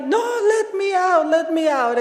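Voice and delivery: high-pitched playful plea to escape; mock protest. Kidnapped friend demanding out of the car, high mock protest. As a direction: imitate a friend begging to be released.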